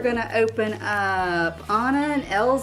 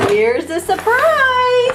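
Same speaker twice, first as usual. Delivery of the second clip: high, slow, loud surprise announcement; excited reveal; 11 semitones higher, 7 dB louder, much slower than usual. Acted. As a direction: build up the surprise. Big reveal to child on camera.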